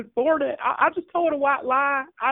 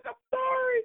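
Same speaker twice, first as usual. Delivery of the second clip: usual speaking voice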